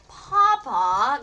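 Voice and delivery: a loud, drawn-out rising plea; wailed. A narrator's reading. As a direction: wail for his father's help. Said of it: "Gunter wailed".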